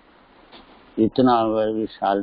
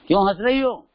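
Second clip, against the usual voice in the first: angry king; 9 semitones higher, faster than usual. Retold or imitated. King repeats his demand.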